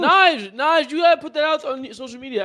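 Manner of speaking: high, loud excited urging. Real, not acted. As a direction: hype up a friend. Excited praise.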